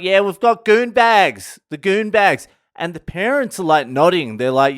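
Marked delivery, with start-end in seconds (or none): 0.0-2.5 high, loud mock-bogan yell; bogan tourists hollering. Bogan tourists telling a tour guide about goon bags, hollering. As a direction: imitate rowdy Aussies proudly yelling.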